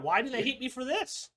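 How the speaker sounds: high, whining mock protest; mock indignant. Mocking a complaining pundit.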